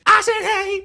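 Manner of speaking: high, loud yell of the line; shouted quote. Retold or imitated. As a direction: belt it out like the trailer. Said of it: "the part where she says".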